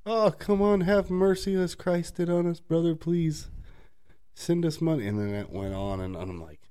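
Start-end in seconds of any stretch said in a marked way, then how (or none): 0.0-5.0 high, pushy pleading imitation; hammed-up pious begging; mock begging scammer.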